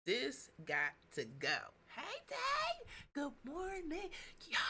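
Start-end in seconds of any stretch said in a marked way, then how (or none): 2.0-2.4 much higher, bright hello to a friend; greeting a friend who joins the live, high and excited. Real, not acted. 3.1-4.6 soft whisper good morning; greeting a friend who just joined, whispered. Real, not acted.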